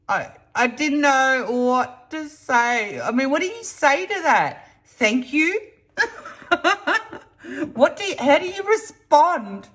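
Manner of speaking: high, incredulous, half laughing; amused disbelief. Real, not acted. Telling viewers about the stranger's advice, high amused disbelief. Baffled reaction retold.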